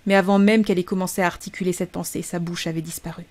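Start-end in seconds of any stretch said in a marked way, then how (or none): none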